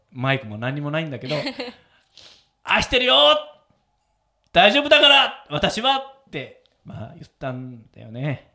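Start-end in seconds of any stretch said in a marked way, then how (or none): 2.6-6.0 high, raised voice imitating a mother; loud retold shout, easing at the end; sudden loud call. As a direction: call out reassurance to someone far off. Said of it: "急に大声で".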